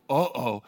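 low dismayed "uh-oh"; worried uh-oh. Acted. Parent looking at the sixteen-year-old, worried. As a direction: groan it at the teenager. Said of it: "looking at your sixteen-year-old like".

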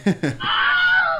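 high shrieking movie scream; Wilhelm scream. Pretend. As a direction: scream like a falling stuntman. Wilhelm scream imitation after request.

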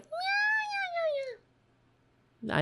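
very high squeaky cat meows; imitated meow. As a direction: mimic the cat's meowing, high and thin. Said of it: "He comes in, he's like".

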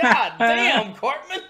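put-on high, loud appalled kid; mock shock. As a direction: exclaim it in hammed-up disbelief. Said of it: "the rest of the kid's like".

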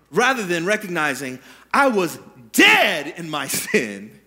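ordinary talk

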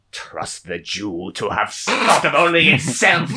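put-on slow monologuing villain with a German accent; hammed-up German baddie, drawing out each word. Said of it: "in a monologuing German villainous voice".

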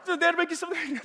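lightly voiced amused grandfather; teasing grandpa. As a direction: ask it with playful surprise.

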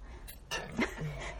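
small cute sneeze, held back; stifled sneeze. Real, not acted. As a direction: suppress a sneeze with all your might. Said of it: "可愛いくしゃみ出たわ、今".

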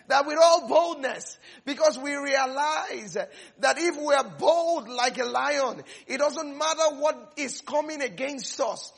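loud, high, laughing fervor; shouting sermon climax; full-force preaching. Acted.

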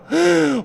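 sharp, high mock gasp; shocked gasp. Lecturer mimics a mother gasping in mock horror.

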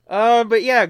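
ordinary talk, nothing marked

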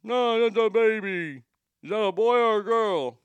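a hint of a small boy puzzling over a baby; kid's voice. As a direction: mimic the child asking, slowed down. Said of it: "He goes".

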